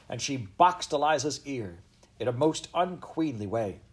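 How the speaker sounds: plain reading voice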